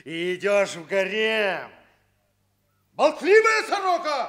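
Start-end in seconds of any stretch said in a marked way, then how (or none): 2.9-4.3 loud, high scolding yell; an exasperated husband yelling at his chatty wife; angry scolding shout. Acted. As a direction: shout her down like a magpie.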